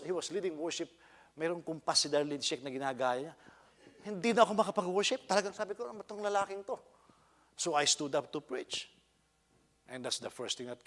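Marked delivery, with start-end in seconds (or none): 4.0-6.8 high, loud retold exasperation; exasperated outburst. Worshipper reacts to a man imitating a female singer, exasperated and loud. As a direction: blurt it out in a raised, worked-up voice.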